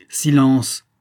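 loud order to be silent; barked command. A narrator's reading. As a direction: shut her up with one loud word. Colossus silences his wife. (ordre crié)

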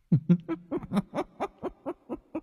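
high eerie cackle rising; cackling ghost laugh. Acted. The ghost bares its fangs at the exorcist, cackling high. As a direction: let out a demon child's cackle. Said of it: "Nó cười khanh khách".

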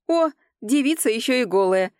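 ordinary talk, nothing marked

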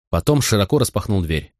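ordinary delivery, nothing marked

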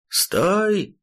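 high yelled command from below; enemy spotted shout. Acted. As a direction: shout "Стой!" up at him, desperate. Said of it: "крикнул уже с земли противник".

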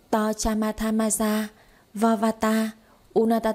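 mumbo-jumbo chant like a sorceress; mock incantation. A narrator's reading. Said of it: "cô nói to".

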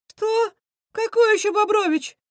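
very high, baffled cartoon villain; high-pitched bewilderment; dumbfounded. A narrator's reading. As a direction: squawk the question in disbelief. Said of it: "опешил Крысион".

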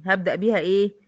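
ordinary talk, nothing marked

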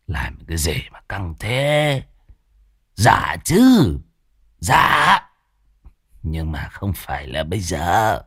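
lightly voiced drunk man; drunk old man. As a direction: brush her off, drunkenly. Drunk man talks back.

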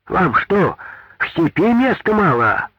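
high, loud indignant cry; shouting old woman. A narrator's reading. Old woman shouting at the foreman again. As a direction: shout at him from the crowd. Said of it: "крикнула опять старушка".

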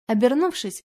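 ordinary delivery, nothing marked

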